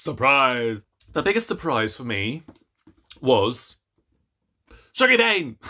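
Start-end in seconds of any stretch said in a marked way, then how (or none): 4.9-5.6 excited reveal. Real, not acted. Announcing surprising book title.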